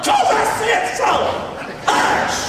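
stern parent shutting it down. Acted. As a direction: bark it like a fed-up parent. Parent silencing child's questions.

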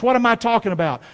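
usual speaking voice

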